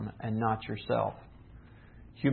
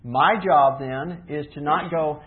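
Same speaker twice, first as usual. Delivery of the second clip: usual speaking voice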